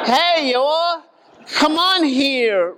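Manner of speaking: high, slow Southern preacher's holler; southern accent. Acted. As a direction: beckon the crowd in a hammed-up southern accent. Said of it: "'cause he has a southern accent".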